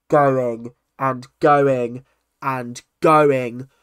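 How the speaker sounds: "going" stretched out slow and loud each time; drawn-out repetition. Real, not acted. Complaining the best man's speech never ended, exasperated stress on "going". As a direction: lean heavily on each "going", dragging it out.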